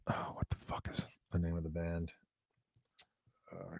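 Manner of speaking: hushed aside to himself; whispering. Real, not acted. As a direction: whisper it under your breath. Forgetting band name, muttered aside.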